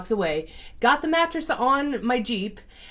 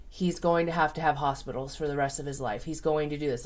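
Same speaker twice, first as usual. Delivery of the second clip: solemn vet; 5 semitones lower, 6 dB quieter than usual. Retold or imitated. Vet delivering grim prognosis.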